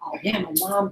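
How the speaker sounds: softly voiced quiet man; imitating quiet talker. Trainer imitates a soft-spoken man chatting about his mom, quiet. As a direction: toss it off softly, as a low-key talker. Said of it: "that's his loudness".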